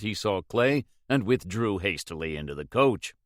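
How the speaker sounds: ordinary delivery, nothing marked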